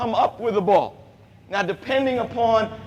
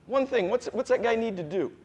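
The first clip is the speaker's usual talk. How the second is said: usual speaking voice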